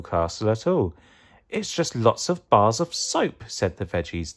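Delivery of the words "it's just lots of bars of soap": higher, mildly let-down voice; understated disappointment; disappointed. A narrator's reading. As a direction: report a letdown.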